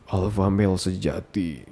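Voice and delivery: mock alpha male.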